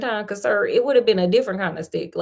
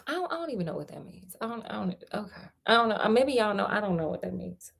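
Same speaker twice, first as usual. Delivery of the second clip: much softer, fast puzzled mumble; confused aside; 8 dB quieter, faster than usual. Real, not acted. Narrator puzzles over the pig line, soft and fast. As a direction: murmur your confusion to yourself.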